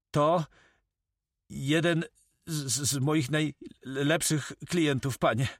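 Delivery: high, halting, nervous stammer; stammering notary. Acted. Notary identifies his client to the corsair, stammering nervously.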